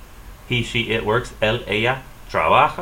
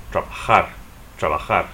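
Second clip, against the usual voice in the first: trabajar stressed on the final -JAR; last-syllable stress. Shown as a demonstration. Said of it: "stressed on the last syllable".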